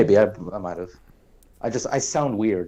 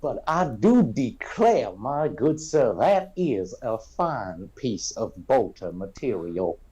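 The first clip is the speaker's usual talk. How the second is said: lightly hammed Southern country boy; cartoon Southern accent. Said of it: "I had to say declare".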